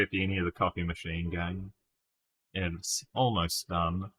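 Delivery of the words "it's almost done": usual speaking voice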